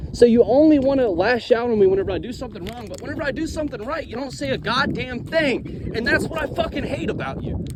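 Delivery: high-pitched angry rant; mad, emotional. Real, not acted. Hurt son telling critics what he hates about them, mad and emotional. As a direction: lash back with real anger, pitch way up. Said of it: "I'm going to get emotional in this video".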